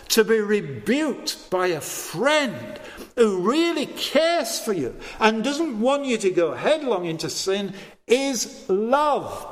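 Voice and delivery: normal talking voice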